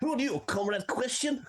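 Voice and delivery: very high-pitched cartoon Russian; terrible Russian accent. Shown as a demonstration. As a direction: squeak it out in a bad Russian accent. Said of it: "That was terrible".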